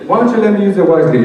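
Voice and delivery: high, loud, fast eager pitch. Retold or imitated. Man pitching his idea to the priest, high fast eager. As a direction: sell the idea quickly. Eager proposition to Father Greg.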